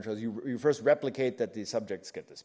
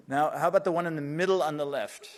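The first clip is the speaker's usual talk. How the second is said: raised voice to the back of the room; calling out; 3 semitones higher, 5 dB louder than usual. Real, not acted. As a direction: call it out across the hall. Calling to tech support.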